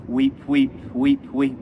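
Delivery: put-on a touch of a small child's street call; mild mock cry of a young chimney sweep. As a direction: call out lightly like a little sweep. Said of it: "my tongue could scarcely cry".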